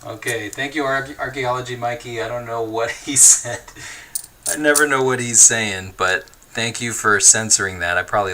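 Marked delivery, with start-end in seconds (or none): none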